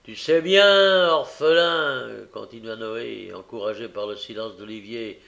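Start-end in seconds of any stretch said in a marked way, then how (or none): 0.0-2.1 pitié moqueuse, forte et lente; feinte compassion. A narrator's reading. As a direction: faire semblant de plaindre pour blesser. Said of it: "d'un ton de feinte compassion". (Noé feint la compassion, fort et lent)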